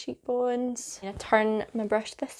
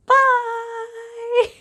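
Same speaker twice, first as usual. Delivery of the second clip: squealed high goodbye; high cheery bye; 13 semitones higher, 6 dB louder than usual. Real, not acted. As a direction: sing out a bright high bye. Cheery sign-off to viewers.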